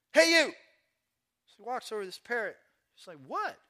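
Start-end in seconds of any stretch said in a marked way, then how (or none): 0.1-0.5 loud, high parrot holler; high-pitched, blaring bird call. Acted. Parrot calls out to man. 3.3-3.6 puzzled reply. Acted. Man answers the parrot.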